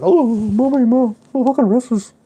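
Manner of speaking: put-on very high, loud crying-kid voice; a kid wailing to his mum about a hurt wrist; wailing child. A dad imagining his son crying to mom. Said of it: "he would have been like".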